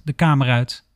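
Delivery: plain reading voice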